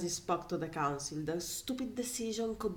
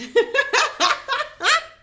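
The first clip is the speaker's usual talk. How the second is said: real burst of loud high laughter; helpless loud cackle; loud uncontrolled laugh; 14 dB louder than usual. Real, not acted. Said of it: "I know I laugh loudly".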